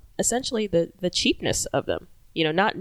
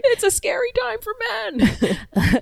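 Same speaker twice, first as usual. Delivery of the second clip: very high, drawn-out mocking wail; mock victimhood; slower than usual. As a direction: play the poor victim in a squeaky, slow put-on voice. Mocking men who claim victimhood.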